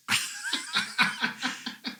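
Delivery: breathy high laugh; delighted laugh at the star knowing him; burst of laughter. Real, not acted. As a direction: laugh out in disbelief. Laughs at Billy's request.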